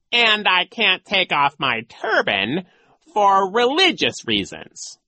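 high, loud, slow excuse; mock-solemn villain. A narrator's reading. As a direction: draw it out theatrically. Disguised villain makes an excuse.

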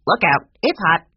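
loud, squawky parrot voice; talking bird. A narrator's reading. Old Mina the bird warning them at supper, loud squawk. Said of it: "a voice said".

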